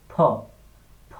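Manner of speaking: "ফ" as a popped p-h, burst of air; "ফ" with lips shut then puffed open; aspirated P. Shown as a demonstration. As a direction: model "ফ" between প and ফ. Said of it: "একটা বাতাস বের হবে".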